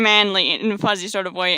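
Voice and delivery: usual speaking voice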